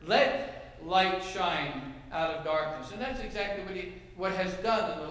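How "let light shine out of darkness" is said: slow, deliberate quoting of "Let light shine out of darkness"; slow scripture reading. Shown as a demonstration. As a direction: read the verse slowly, with weight. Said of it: "God says in his word".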